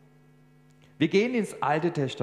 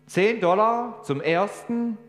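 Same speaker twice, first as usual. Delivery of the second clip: slow, loud and higher going once; gavel call; 9 semitones higher, 6 dB louder, slower than usual. Acted. Auctioneer to the hall, slow loud going-once call. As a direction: announce the first call grandly.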